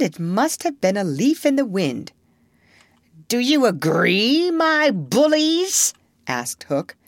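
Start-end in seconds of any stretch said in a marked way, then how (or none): 3.3-5.9 high, drawn-out pirate captain; rallying pirate captain. Acted. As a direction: rouse the crew slowly. Hook rallying his crew.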